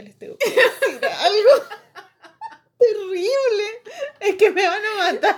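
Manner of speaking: high, loud, laughing dread; mock-desperate, cracking up; laughing retold confession. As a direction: blurt out bad news through laughter.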